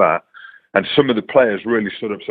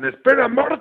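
high-pitched mock yell of alarm; retold shout. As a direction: shriek it out like a panicked bystander. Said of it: "someone shouting".

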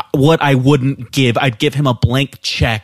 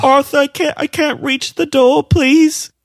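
high, pleading small voice; imitating the frightened coworker, much higher; scared little man pleading; 13 semitones higher than usual. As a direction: beg him to let you go, pitched up high. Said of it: "he's just like".

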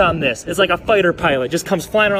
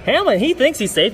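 ordinary delivery

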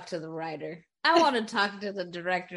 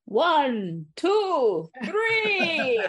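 counting high and loud, with a drawn-out cackle; hammed-up villain's count and laugh; 6 semitones higher, 3 dB louder, much slower than usual. Announcing that the Count is back, gleefully hammed-up. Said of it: "the Count is also back".

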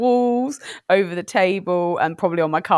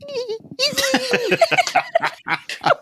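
chirpy clicking dolphin. Pretend. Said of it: "[name]'s best dolphin impression will begin now".

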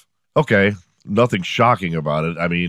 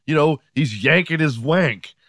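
higher-pitched mock police caller; 7 semitones higher than usual. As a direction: report it like an agitated caller. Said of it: "call the police and be like".

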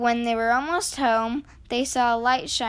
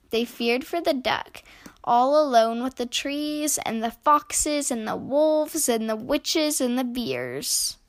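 plain reading voice

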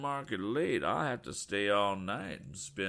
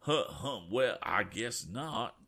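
put-on slow, drawn-out rural farmer's accent; 4 semitones higher, slower than usual. Stubborn farmer refusing the motorists, slow rural accent. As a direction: drawl it like a stubborn country farmer. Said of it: "in unmistakable farmer's accents".

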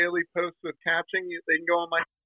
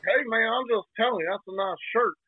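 put-on Florida accent; 6 semitones higher than usual. Florida guy explains himself to Jersey man, mock Florida accent. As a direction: imitate an innocent Orlando local's accent. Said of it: "people don't talk like that in Orlando".

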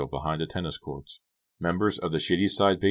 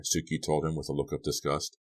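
ordinary delivery

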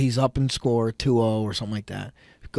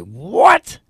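shocked what; 7 semitones higher, 9 dB louder than usual. Retold or imitated. Hearing his son got pinned.

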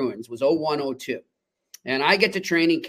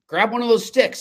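retold higher call; 6 semitones higher than usual. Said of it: "Cash goes".

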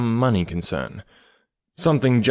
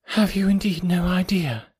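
lightly trembling, higher voice; a touch of a tremble; 8 semitones higher than usual. Acted. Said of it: "in a trembling voice".

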